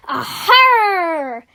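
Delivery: loud, drawn-out pirate roar; big swaggering pirate cry. Acted. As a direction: roar it out high and long like a pirate. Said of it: "roared Bertie".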